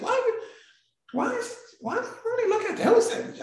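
high-pitched, hurried imitation of her challenge; retold incredulous questioning. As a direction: question them in a high, rushed voice. Retold friend's baffled challenge.

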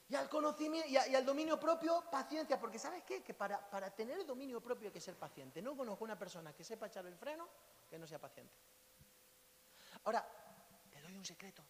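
usual speaking voice

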